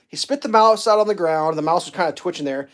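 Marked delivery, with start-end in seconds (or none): none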